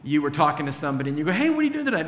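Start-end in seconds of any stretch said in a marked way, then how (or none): 1.3-2.1 bright high hey to a friend; cheery invite. Pretend. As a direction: call out a friendly invite, pitched up.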